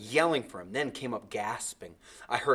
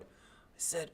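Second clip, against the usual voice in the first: ordinary delivery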